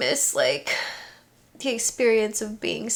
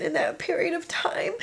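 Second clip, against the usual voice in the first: tearful; 6 semitones higher, 4 dB quieter than usual. Real, not acted. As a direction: voice cracking into tears. Crying while recalling Florida.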